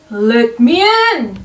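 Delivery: loud, far pirate demand; pirate shouting at the door; pirate crying out. Acted. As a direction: bellow it at the door. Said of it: "A voice cried".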